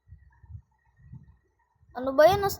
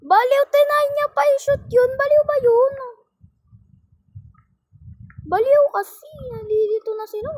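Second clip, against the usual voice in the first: higher, much louder furious rant; angry ranting; 10 semitones higher, 10 dB louder than usual. Real, not acted. Gamer raging at a teammate who shot instead of passing, loud and high.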